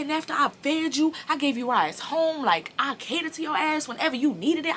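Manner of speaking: high, loud, near tears; a hurt, furious telling-off; retold tirade. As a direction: rant at him, voice cracking.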